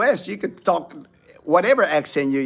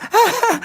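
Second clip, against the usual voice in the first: high, loud laugh; burst of laughter; 6 dB louder than usual. Real, not acted. Host amused by a viewer's question, bursting out laughing.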